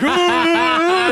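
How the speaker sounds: high, loud scream through laughter; laughing scream. Retold or imitated. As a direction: shriek and laugh at once. Being rammed into others.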